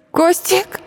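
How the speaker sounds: high call cracking into a sob; voice breaking into a sob. A narrator's reading. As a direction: let the voice break on the name. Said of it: "голос сорвался на всхлип".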